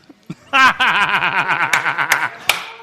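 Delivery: long, loud, rattling guffaw; loud laughter. Real, not acted. Co-host hit by Lincoln theater joke, loud guffaw. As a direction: burst out in sustained loud laughter.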